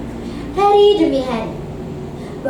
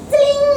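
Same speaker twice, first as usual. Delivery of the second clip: high ringing "celing"; magic chime sound; 12 semitones higher, 4 dB louder than usual. A narrator's reading. Narrator marking the dove's transformation, magic chime. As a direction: sing out a high, drawn-out magical ting.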